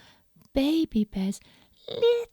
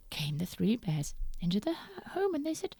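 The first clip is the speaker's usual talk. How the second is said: ordinary delivery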